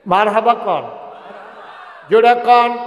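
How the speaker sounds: high, loud call for a response; rallying shout. Real, not acted. Preacher urging the crowd to say marhaba, loud shout. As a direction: whip the crowd into answering.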